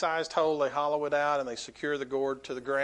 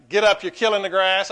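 a mock coach barking, louder; coach's yell; 4 semitones higher, 9 dB louder than usual. Coach yells at a player lying on the field, mock bark. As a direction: shout it like an old-school football coach. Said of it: "they'd say things like".

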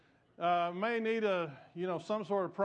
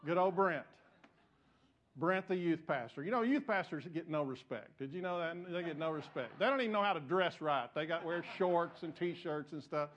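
ordinary talk, nothing marked

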